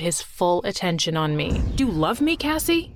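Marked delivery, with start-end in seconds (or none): 1.8-2.9 high, rising disbelief; shocked question. A narrator's reading. Jerry to his crying wife, shocked. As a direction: ask in startled wonder, pitch climbing. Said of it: "said with a shocked look".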